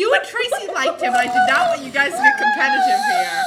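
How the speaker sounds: high, loud arguing; heated protest. Real, not acted. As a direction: shout your denial over the teasing. Heated argument over who liked him.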